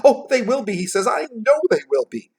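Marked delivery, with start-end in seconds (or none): 0.0-0.8 high, loud eager outburst; getting excited. A narrator's reading. Scrooge sure the shadows will be dispelled, excited. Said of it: "He's getting a little excited". 1.1-2.3 high, loud insistence; getting excited. A narrator's reading. Scrooge convincing himself, excited. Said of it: "He's getting a little excited".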